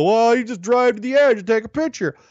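high, put-on mocking voice; mock impression. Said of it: "He's gonna sleepwalk his way through it and go".

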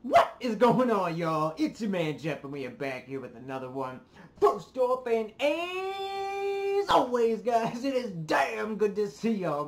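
loud, high-pitched intro with stretched words; big drawn-out shout of welcome; hyped video greeting. Real, not acted. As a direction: hype up the viewers, drawing words out. High-energy greeting to viewers.

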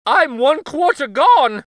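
high, loud wail of alarm; a little cookie losing a bite; frightened cry. Acted. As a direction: panicky high-pitched gingerbread boy. Gingerbread boy being eaten.

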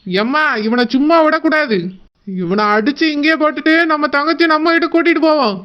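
angry brother. Acted. Family fight, voiced by S1.

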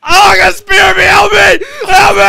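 high panicked yell; screaming for help. Real, not acted. Speared in game, screaming.

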